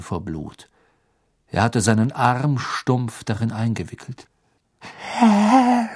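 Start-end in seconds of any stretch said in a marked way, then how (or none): 4.8-5.9 high, strangled whimper; choking servant voice. Acted. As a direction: gasp it out, choking and desperate. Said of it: "würgte er hervor".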